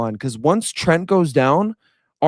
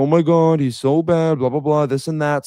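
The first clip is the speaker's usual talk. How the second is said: mocking stat-box critics. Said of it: "They're gonna be like".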